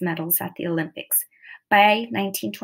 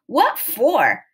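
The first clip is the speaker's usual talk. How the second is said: higher, louder defiant retort; yelled back; 4 semitones higher, 5 dB louder than usual. A narrator's reading. Determined Trudy answers the crew's call to quit, yelling back. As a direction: yell it back with big pitch swoops. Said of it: "yelled back".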